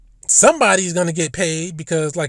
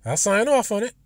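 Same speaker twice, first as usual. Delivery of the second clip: higher, breezy careless sign-off; lightly voiced careless president, higher; naive president voice; 6 semitones higher, 3 dB quieter than usual. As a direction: play her as a president who signs without reading. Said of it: "She might say".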